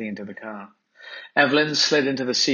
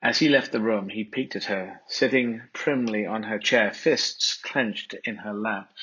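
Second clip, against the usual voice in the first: ordinary delivery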